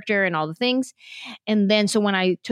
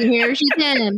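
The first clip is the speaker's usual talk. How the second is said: slow, higher drawl; imitated drawl; 4 semitones higher, slower than usual. Shown as a demonstration. Comedian mimicking the host's drawl, slowly drawled. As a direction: stretch it into a playful drawl. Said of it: "you have a drawl".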